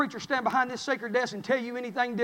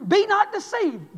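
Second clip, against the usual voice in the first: "be not deceived" high, slow and loud; rising preacher cry; 9 semitones higher, 6 dB louder, slower than usual. Real, not acted. Preacher builds on scripture.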